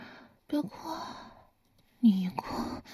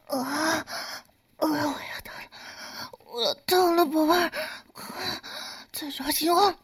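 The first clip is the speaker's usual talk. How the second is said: 越来越高越来越急的叫喊; 呻吟一路攀升到顶点; climaxing cries; 7 semitones higher, 5 dB louder than usual. Acted. Climax, voice rising and crying out. (高潮时越喊越高)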